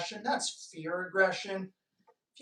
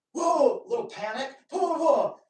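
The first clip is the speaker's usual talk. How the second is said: startled whoa; 6 semitones higher, 5 dB louder than usual. Retold or imitated. Imitating dog's panic reaction.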